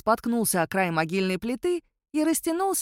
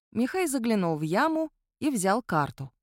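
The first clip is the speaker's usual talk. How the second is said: ordinary delivery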